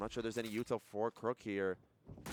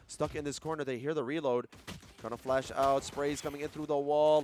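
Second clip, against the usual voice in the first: usual speaking voice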